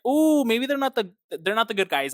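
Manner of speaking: high, loud ooh of discovery; mock-surprised dawning; surprised realization. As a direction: gasp at the twist. Voicing a realization.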